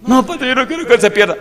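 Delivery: loud, high-pitched mock protest; upset wife pleading. Wife protesting to the pastor about her husband, high pleading.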